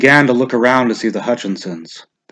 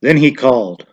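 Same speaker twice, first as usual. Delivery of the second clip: usual speaking voice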